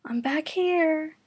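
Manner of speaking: high, slow sing-song put-on voice; chiming. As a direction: chime it brightly from the trunk. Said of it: "Kurama chimed".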